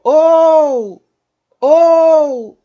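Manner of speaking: "ou" bem forte e agudo, alongado e descendente; O forte, U sumindo. Shown as a demonstration. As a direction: sustente o O de "ou" até o U sumir. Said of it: "o O forte e o U quase sumindo". ("ou" esticado e decrescente)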